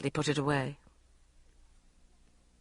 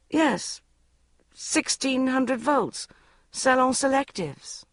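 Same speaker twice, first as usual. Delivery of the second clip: higher and slow, a touch of pride; proudly; 7 semitones higher, slower than usual. A narrator's reading. Bridget to Mark Darcy, boasting about her hairdryer, mildly proud. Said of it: "I said proudly".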